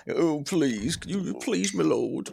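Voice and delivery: a lightly hammed-up plea to a lord; pleading impression. Co-host mocking his own tired plea, lightly begging. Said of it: "impression of what I was coming through as".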